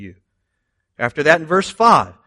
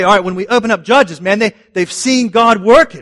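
higher and louder, full of hope; eager Israelites; 6 semitones higher, 5 dB louder than usual. Pretend. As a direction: voice the people cheering each other on. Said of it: "we might expect ... to say".

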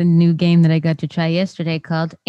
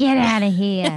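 Boston accent, dropped r on here. Shown as a demonstration. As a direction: Get outta here in a Boston accent, outta as "outa", here as "heah". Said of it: "With a Boston accent".

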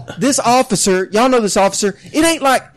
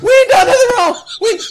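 laughing mock plea; 12 semitones higher, 3 dB louder than usual. Friend yelling innocence, laughing.

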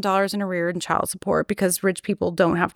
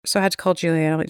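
normal talking voice

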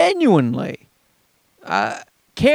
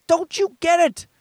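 exasperated plea; 9 semitones higher than usual. Retold or imitated. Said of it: "And I'm like".

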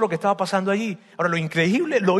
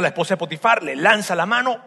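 ordinary talk

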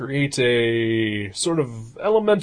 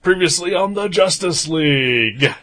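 dramatic announcer; 5 semitones higher, 4 dB louder than usual. Pretend. Recap intro, TV announcer style.

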